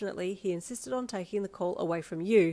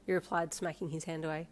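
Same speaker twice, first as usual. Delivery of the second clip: ordinary delivery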